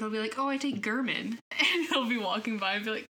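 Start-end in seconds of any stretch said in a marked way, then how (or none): 0.4-1.4 German with a hard G, GUR-min; deliberate mispronunciation. Retold or imitated. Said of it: "I specifically go German instead of German".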